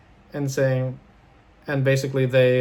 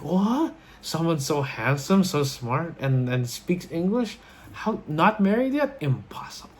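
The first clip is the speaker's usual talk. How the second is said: mock disbelief; 4 semitones higher than usual. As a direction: imitate an incredulous, gushing grandmother. Grandmother's mock disbelief at doctor.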